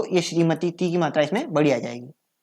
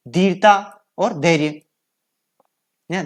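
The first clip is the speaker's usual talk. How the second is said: ordinary talk, nothing marked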